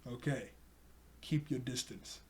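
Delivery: low, quiet retold warning; understated keep-back warning; wary warning. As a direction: drop the voice and softly warn them off.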